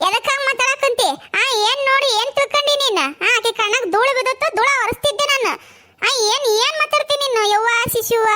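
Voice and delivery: high, rapid angry protest; indignant self-defence. Acted. Defends herself against false accusation.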